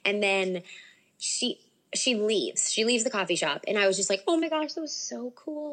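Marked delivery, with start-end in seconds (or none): 4.3-5.7 high-pitched retold fan excitement; giddy, higher re-enactment; starstruck gushing. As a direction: gush it, imitating your starstruck self. Retells her starstruck reaction.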